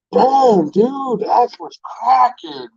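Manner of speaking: high, hyped-up imitation of a passerby; excited passerby voice; impressed passerby. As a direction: play the guy leaning out his window, impressed. Passersby hyping his rims.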